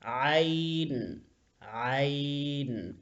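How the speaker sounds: عین held very slowly, long vowel; drawn-out letter name. Shown as a demonstration. Teacher to pupils, sounding out عین slowly. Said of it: "اس کو ہم عین پڑھیں گے".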